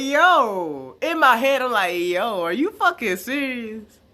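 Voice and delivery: high, loud, drawn-out incredulous outburst; loud disbelief. Retold or imitated. Venting in his head when she blames him, loudly incredulous.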